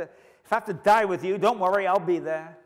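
loud, high, confident vow; loud boast. Acted. Preacher recalling Peter's boast before the denial, loud. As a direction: swear it with bravado.

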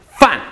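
FAN high and loud, falling sharply; sharp drop on FAN; fourth tone. Shown as a demonstration. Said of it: "fan, fourth tone".

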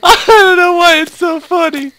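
high, loud, crying with laughter; can't stop laughing; tearful giggle; laughing to tears. Real, not acted.

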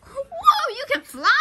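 high, loud astonishment; amazed. Acted. Friend seeing the other one fly, amazed.

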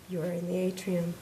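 a gentle woman speaking from above and behind; calm facility voice. Acted. The building's voice telling Mary where she is, calm and soft.

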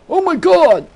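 high, very loud mock scream of shock; deliberate shrieked "oh my god" to clip the audio; sudden shriek. Shown as a demonstration. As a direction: shriek it to blow the meter. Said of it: "Like I just did and went off the scale there".

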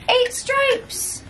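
high, triumphant end of a count; excited counting. Real, not acted. Finishing a count for a toddler.

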